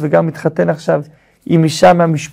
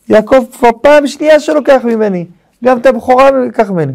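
higher-pitched retold outcry; mock wailing complaint; 6 semitones higher, 3 dB louder than usual. Esau protesting Jacob took from him, mock wailing. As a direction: imitate an aggrieved son crying out. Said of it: "צועק צעקות".

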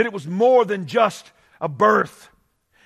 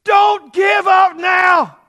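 loud, high, slow cry of encouragement; excited urging; 11 semitones higher, 9 dB louder, much slower than usual. Pretend. Joseph urging Mary to push, loud and high. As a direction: urge her on, bursting with hope. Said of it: "He says something like".

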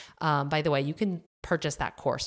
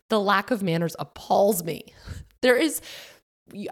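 ordinary talk

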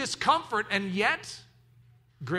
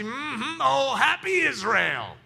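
Southern hum into slow exclamation; stretched, delighted Southern approval; Southern mm-hmm; 4 semitones higher, slower than usual. Shown as a demonstration. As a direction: agree with relish, Southern style. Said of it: "the Southern, mm-hmm".

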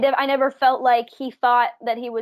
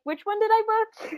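higher-pitched retold embarrassed question; embarrassed puzzlement; 10 semitones higher than usual. Young actor recalling forgetting which audition she booked, embarrassed puzzlement. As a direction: play back the sheepish confusion, voice lifted high. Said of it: "it was kind of embarrassing".

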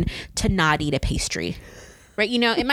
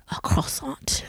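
whisper; 7 semitones higher, 5 dB quieter, slower than usual. Real, not acted. Confiding her pastry habit.

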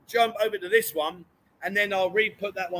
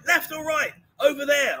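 higher, exasperated question; exasperated rising; 6 semitones higher than usual. Retold or imitated. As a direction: raise pitch, losing patience. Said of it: "I'm like".

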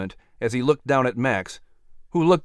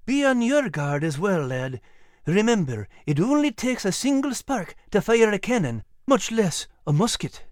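higher Scottish voice, earnest counsel; advising old Scottish sergeant; 8 semitones higher than usual. Acted. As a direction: caution a lad like an old Scottish soldier. Old sergeant advises young Henry.

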